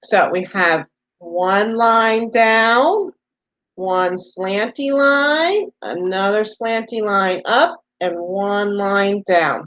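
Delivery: slow teaching. Shown as a demonstration. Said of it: "so we know what it looks like".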